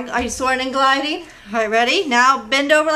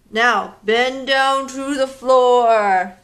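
very slow, a touch louder; long drawn-out instruction; slow stretch cue; 4 dB louder, much slower than usual. Shown as a demonstration. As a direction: drag it down as you bend. Leading kids' movement game.

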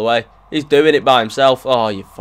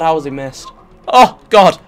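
usual speaking voice

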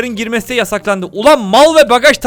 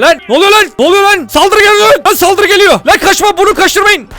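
much higher, loud alarmed yells; panicked shouting; 11 semitones higher, 7 dB louder than usual. Real, not acted. Streamer shouts at guards during sudden attack, panicked.

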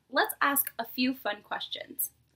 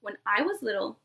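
lively picture-book read-aloud to a class; storybook voice for a nursery class; reading to children; 2 semitones lower, 4 dB louder, slower than usual. Acted. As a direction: read it like story time for little kids. Teacher reads picture book aloud.

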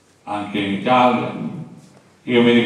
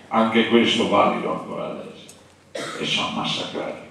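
ordinary delivery

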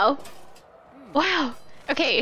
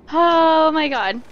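surprised exclamation; 3 semitones higher, 4 dB louder, slower than usual. Real, not acted. Surprised shout.

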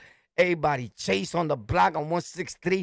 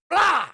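smack noise; 11 semitones higher, 4 dB louder than usual. Retold or imitated. Smack sound effect.

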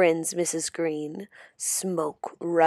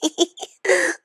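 evil laugh; 10 semitones higher than usual. Acted. Witch shrinks girl's head.

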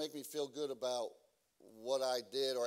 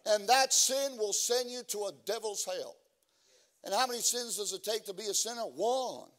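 preaching; 6 semitones higher, 6 dB louder than usual. Acted. Loud preaching continues.

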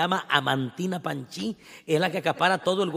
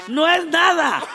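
eager flattery; 11 semitones higher, 7 dB louder, slower than usual. Retold or imitated. Owner flattering the captain.

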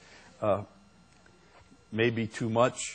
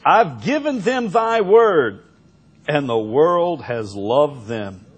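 loud, slow scripture proclamation; forceful preaching; 4 semitones higher, 9 dB louder, slower than usual. Acted. Preacher quotes verse fourteen to congregation, forceful proclamation.

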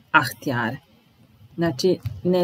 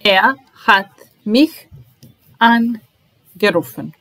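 angerufen drawn out syllable by syllable; slow model word; 3 semitones higher, 4 dB louder, slower than usual. Shown as a demonstration. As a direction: stretch angerufen for the class. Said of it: "teacher models the participle slowly".

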